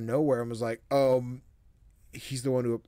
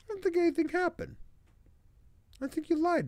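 squeaky complaint. Real, not acted. Complaining the game tricked him.

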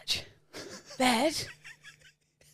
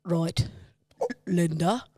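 attempted impression; 6 semitones lower, 4 dB quieter than usual. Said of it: "We need comparison".

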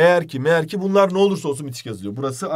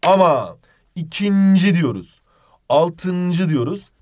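"ikinci" said slow and louder, set against the previous ones; emphatic contrast; 4 dB louder, much slower than usual. Shown as a demonstration. Teacher to students, stressing the exceptions slowly.

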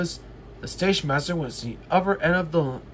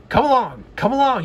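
loud and high, bossy and rude; rude call; 8 semitones higher, 6 dB louder, faster than usual. Acted. James hurrying the coaches, rude loud call. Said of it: "he called rudely".

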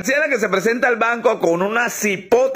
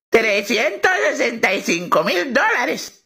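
put-on a slow, sweet old lady; 3 semitones higher, slower than usual. Old lady repeats sum.

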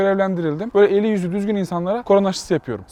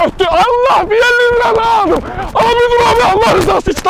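high loud pleading beggar; begging whine; 7 dB louder than usual. Acted. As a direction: plead shrilly for money. Begging for money in prank.

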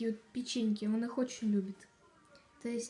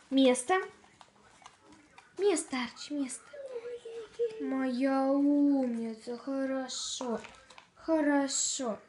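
loud dog commands; 2 semitones higher, 4 dB louder, slower than usual. Real, not acted.

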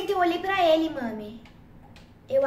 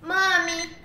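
usual speaking voice

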